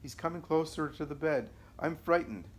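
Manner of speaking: narrated terror, voice lifted high; terrified calling out. A narrator's reading. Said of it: "shouting in her terror and distress".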